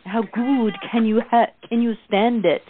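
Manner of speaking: put-on a friend's lilting Swedish accent. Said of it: "in her delightful Swedish accent".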